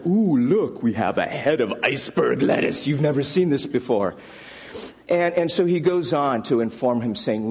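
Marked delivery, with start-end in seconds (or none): 0.1-4.2 mock-naive showing off; mocking sing-song. Colleague mocking Cam's lettuce gift with mock wonder. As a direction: sneer as if presenting a treasure.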